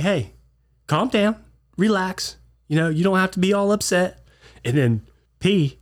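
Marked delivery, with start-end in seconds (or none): 0.0-4.2 high, soothing placation; calming down. Retold or imitated. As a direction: settle an angry man down. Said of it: "I'm like".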